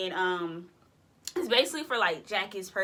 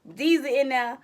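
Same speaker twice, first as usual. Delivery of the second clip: normal talking voice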